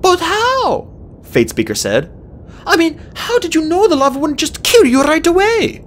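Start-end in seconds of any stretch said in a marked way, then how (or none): 0.0-0.9 put-on high, eager girl dragon; young female dragon. A narrator's reading. Fate Speaker asks. 2.6-5.8 put-on high, eager girl dragon; young female dragon. A narrator's reading. Fate Speaker asks.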